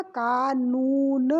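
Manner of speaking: कानून said slowly, the ऊ drawn out; sounding out the word. Shown as a demonstration. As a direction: stretch the ऊ in कानून. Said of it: "कानन नहीं होता". (कानून — धीरे, ऊ खींचकर)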